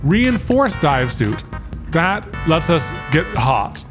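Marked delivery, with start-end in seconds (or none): none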